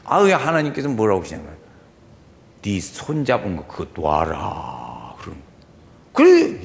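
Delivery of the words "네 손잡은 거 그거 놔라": low, slow order to let go; calm command from God. Retold or imitated. God telling the deacon to release the branch, low and slow. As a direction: lower the voice and command slowly.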